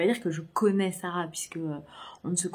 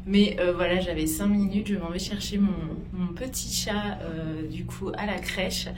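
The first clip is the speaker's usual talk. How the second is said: on a bumpy road; 5 dB louder than usual. Real, not acted. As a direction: voice jolted by a shaking cable car. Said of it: "Alors désolée si ça secoue à mort".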